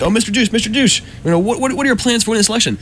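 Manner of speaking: high, quick mock interviewer; squeaky mock reporter voice from the cartoon. Retelling South Park, a high mock interviewer voice. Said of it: "so they're like".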